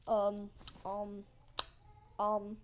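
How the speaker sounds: acted hesitant ums, spaced apart; stalling hesitation. Man caught without the queen's daughter, stalling. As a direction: stall with separate, awkward ums.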